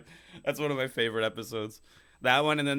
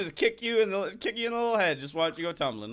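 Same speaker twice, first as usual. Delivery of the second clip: higher, playful bullying voice; mock taunting; 8 semitones higher than usual. Giant player taunting the short one, mock bullying. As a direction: tease him like a tiny child.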